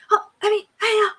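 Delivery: high, loud panicked gibberish; freaking out. Retold or imitated. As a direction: imitate people shrieking in astonishment. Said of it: "They're freaking out. They're going".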